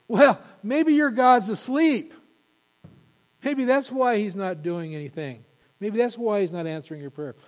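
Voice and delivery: mock them like Elijah did; mocking taunt. Elijah mocks the Baal prophets.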